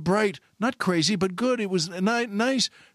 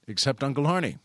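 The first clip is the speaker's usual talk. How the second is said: usual speaking voice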